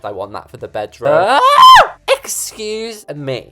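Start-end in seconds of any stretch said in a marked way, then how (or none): 1.1-1.4 loud, high yell of shock; startled scream. Real, not acted. 2.1-3.5 high, drawn-out outrage; indignant. Real, not acted. Indignant after the unwanted result.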